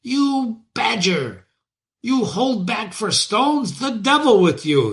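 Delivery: high-pitched furious carter's shout; mad shouting driver. Acted. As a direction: bellow at the stuck horse like a man gone mad. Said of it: "lashed and shouted as if mad".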